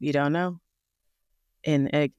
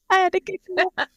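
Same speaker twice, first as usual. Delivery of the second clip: laughing; 6 dB louder, faster than usual. Real, not acted.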